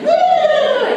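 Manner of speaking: very high, loud woo; piercing long hoot; high whoop. Acted. Mimics an unneeded noisy switch.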